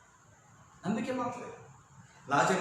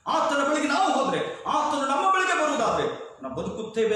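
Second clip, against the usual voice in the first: loud, high pulpit cry; forceful preaching; 8 semitones higher, 6 dB louder, faster than usual. Real, not acted. Preacher urging the congregation to come to Him, forceful. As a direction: preach it out with raised voice.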